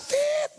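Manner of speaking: high-pitched imitated bleep; censor beep. Preacher bleeping out a worldly person's swearing, high censor beep. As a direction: squeak out a sustained censor beep. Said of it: "Banyak sensornya".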